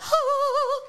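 high, drawn-out gasp; imitated gasp of a dog taken aback; mock shocked gasp. Imitating the dog's shocked look.

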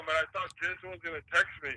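ordinary talk, nothing marked

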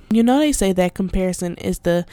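ordinary delivery

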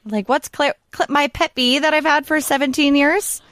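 put-on high, upset child's voice; little girl voice. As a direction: play the worried young daughter. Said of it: "She's like".

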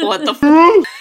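loud, high, laughing shock; shocked outburst. Retold or imitated. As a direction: blurt it out stunned. Retelling shocked reaction to scene.

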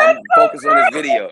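telling how he ignored the manager, loud mock dismissal; loud dismissive. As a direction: toss it off like he doesn't care. Shrugging Carl off, loud.

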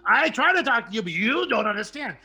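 fast, loud torrent of finally being heard; excited rant. Acted. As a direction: rattle it off like a pent-up talker. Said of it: "Greg goes".

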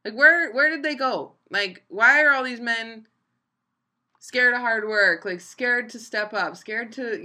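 high, loud exasperated rant; coworker venting. Retold or imitated. Coworker ranting in the back room, loud and exasperated. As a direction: blow up in disbelief at lazy men. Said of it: "she's like".

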